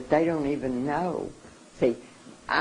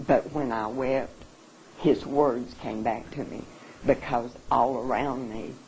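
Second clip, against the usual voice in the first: usual speaking voice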